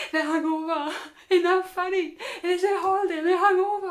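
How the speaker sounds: high, loud imitation of a friend; mock a friend blurting it out for all to hear; tactless loud remarks. Friend mocking hungover strangers within earshot, loud and high. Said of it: "Speak a little bit louder".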